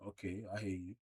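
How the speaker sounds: ordinary delivery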